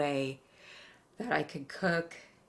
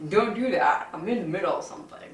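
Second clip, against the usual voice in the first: laughing scold at cat; 3 semitones higher, 6 dB louder than usual. Real, not acted.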